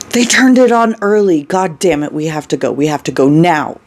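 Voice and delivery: alarmed and pressing, starting at a peak; panicked urgency. Acted. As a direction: urge them to flee right now. Terrified character urging immediate escape.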